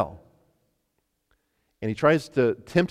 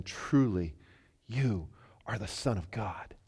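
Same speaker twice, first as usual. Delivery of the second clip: hushed awe; 4 semitones lower, 8 dB quieter, slower than usual. Acted.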